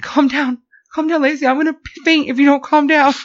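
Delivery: high, loud, frantic pleading to calm down; panicky pleading. Retold or imitated. Telling a panicking sister to calm down, high frantic pleading. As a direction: squeal it like you might faint any second. Stuck elevator, scared, pleading.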